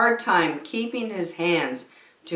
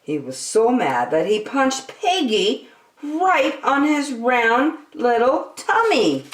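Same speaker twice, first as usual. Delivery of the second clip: ordinary delivery, nothing marked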